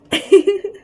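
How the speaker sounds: loud, bursting laugh at herself; a sudden embarrassed laugh after the scream; real laugh. Real, not acted. Narrator laughing loudly at her own retold scream.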